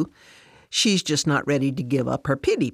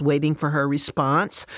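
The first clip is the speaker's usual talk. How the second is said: ordinary delivery, nothing marked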